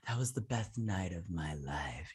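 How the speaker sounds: a mock lovers' whisper; breathy whisper. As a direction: whisper the line, soft and intimate. Quoting a lovers' afterglow line.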